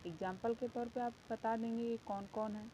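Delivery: ordinary delivery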